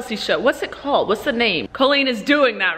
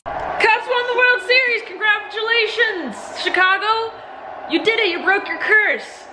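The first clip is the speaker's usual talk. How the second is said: excited cheer; 9 semitones higher than usual. Real, not acted. Cheering the Cubs win.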